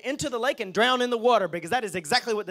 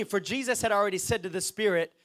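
normal talking voice

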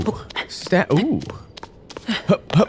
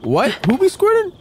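mock surprise; 12 semitones higher than usual. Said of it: "I was like".

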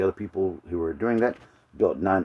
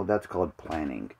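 normal talking voice

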